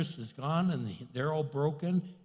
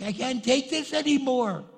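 high, raised cry of exasperation; acted desperation; 7 semitones higher, 4 dB louder than usual. Preacher acts a believer hounded by the devil, exasperated cry. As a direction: act at the end of your rope, pitch climbing.

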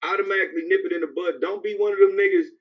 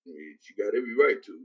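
meek mumble; 11 semitones lower, 7 dB quieter than usual. Retold or imitated. Voicing the meek man in song.

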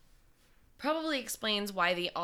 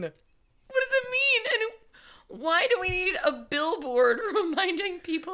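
laughing; 9 semitones higher, 4 dB louder than usual. Real, not acted. Still laughing.

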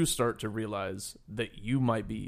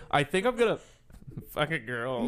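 imitating her reaction; 3 semitones higher than usual. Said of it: "Your reaction to your own words".

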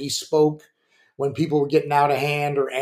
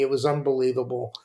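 ordinary talk

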